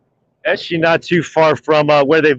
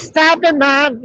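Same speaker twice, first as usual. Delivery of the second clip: high-pitched whiny imitation of her; mock giggly daughter; 10 semitones higher, slower than usual. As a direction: mimic her protesting to mom, pitched way up. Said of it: "she starts giggling and shit".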